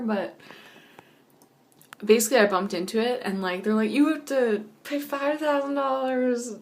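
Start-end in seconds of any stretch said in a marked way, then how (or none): 3.9-6.5 slow, higher voice of a stern official; stern demand voice. Retold or imitated. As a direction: lay down the demand slowly. Others demand payment in dream.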